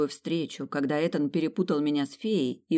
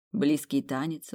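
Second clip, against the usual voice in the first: usual speaking voice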